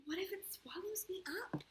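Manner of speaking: high, breathy, scared whisper; fearful whisper. Acted. The boy fears his problem will swallow him, scared whisper. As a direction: whisper it like a frightened child. Boy's fear in story.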